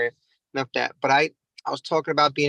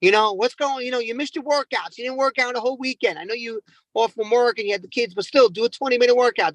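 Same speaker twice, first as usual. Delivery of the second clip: mock scolding; 7 semitones higher than usual. Trainer scolding a client who skipped workouts, raised and sharp. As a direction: snap at them like a fed-up coach. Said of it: "I wanna snap at them and just yell and scold at them".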